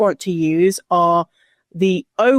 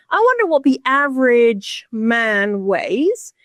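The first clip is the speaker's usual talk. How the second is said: slow, higher-pitched curious voice; mock musing; 4 semitones higher, slower than usual. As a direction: wonder aloud as a caricatured statistician. Acting out statistician's musing.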